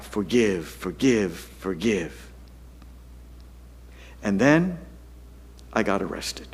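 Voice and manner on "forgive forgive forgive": ordinary talk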